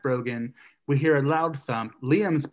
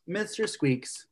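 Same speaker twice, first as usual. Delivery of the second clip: ordinary delivery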